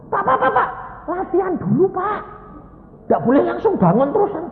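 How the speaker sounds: high, loud mock alarm; flustered nurse. Nurse stopping a patient getting out of bed, flustered cries. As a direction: plead frantically as a panicked nurse. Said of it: "susternya kan kebingungan".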